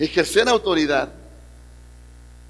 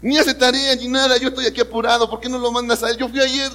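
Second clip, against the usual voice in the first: put-on higher, complaining kid voice; whiny rebel; 6 semitones higher than usual. Rebellious inner voice complains to father, higher and whiny. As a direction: protest like a put-upon son.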